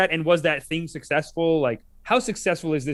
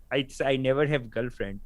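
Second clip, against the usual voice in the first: put-on slow, lower, halting Indian accent; 4 semitones lower, 6 dB quieter than usual. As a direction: imitate a timid Indian guy's accented English, slowly. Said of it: "they'll bring up one Indian guy".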